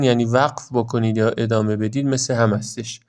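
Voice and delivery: ordinary talk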